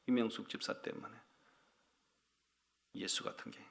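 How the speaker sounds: echoing the complaint to himself, soft and low; muttered repeat. Retold or imitated. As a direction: mutter the name to yourself. Repeats name under breath.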